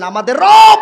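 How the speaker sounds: high, loud burst on রব. Shown as a demonstration. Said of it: "এত আস্তে বলতেছেন".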